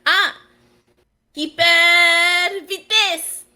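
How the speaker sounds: excited French game shout. Acted. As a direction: call it out like a racing game announcer. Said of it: "That's also French".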